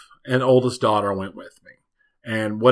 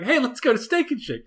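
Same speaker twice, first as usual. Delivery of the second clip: high cheerful mock voice; 10 semitones higher, 4 dB quieter than usual. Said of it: "it's like".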